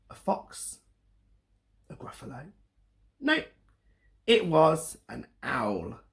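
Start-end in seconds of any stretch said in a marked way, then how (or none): 0.1-2.5 breathless whispered guesses. Acted. Teacher whispering guesses to young listeners. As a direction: whisper it like a secret.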